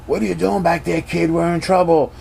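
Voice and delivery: high, urgent call without much volume; Han Solo mock yell. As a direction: call back to the kid in acted alarm. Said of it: "Han yells out".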